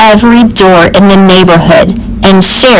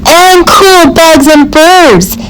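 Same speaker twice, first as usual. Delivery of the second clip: put-on chiming-in kid; 7 semitones higher, slower than usual. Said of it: "chimed in".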